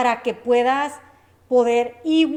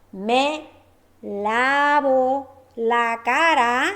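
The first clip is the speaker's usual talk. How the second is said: very slow and drawn out, each syllable clear; slow teaching demo; much slower than usual. Shown as a demonstration. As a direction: spell the phrase out slowly for the learner. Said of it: "Me lavo es del verbo lavar".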